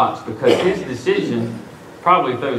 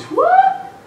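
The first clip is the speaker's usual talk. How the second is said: squeaky high astonished mock yelp; high-pitched, comic "everybody" voice. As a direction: pop it way up high, imitating stunned onlookers. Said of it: "in a very high-pitched voice".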